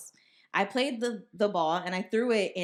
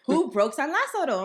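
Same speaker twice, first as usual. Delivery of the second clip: mom demanding who did it; stern demand; 8 semitones higher, 6 dB louder than usual. Retold or imitated. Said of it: "she's like".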